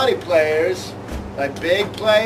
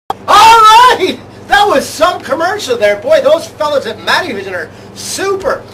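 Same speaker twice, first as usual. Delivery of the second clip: high, loud mock announcer; announcer shout; 5 semitones higher, 6 dB louder than usual. Co-host hyping after the fake ad, loud announcer. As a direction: boom like a cheesy radio host.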